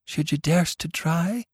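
half in awe of the daring; awe-stricken. A narrator's reading. Rhody asking Tilly if she dares, awed and hushed. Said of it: "in an awe-stricken tone".

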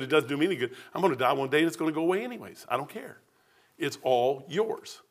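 low, soft, offhand yielding; quiet surrender. Retold or imitated. Preacher giving everything back to God, quietly. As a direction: hand it all over quietly.

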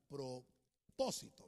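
drawn out, broken after the first syllable of "Propósito"; slow dictation. Shown as a demonstration. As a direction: say "Propósito" low and slowly, as if writing it up. Dictating the word while writing it.

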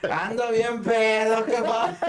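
put-on high, slow, slurred drunk confession; slurring to his mother at the door. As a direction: drag the words like a proud drunk kid. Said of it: "abre la puerta y yo".

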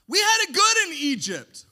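loud, high spoiled rebel; Korah's whine. Retold or imitated. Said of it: "I can just imagine seeing him saying".

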